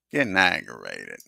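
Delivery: aggravated repeat. Real, not acted. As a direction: repeat it with real irritation. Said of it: "I'm getting aggravated".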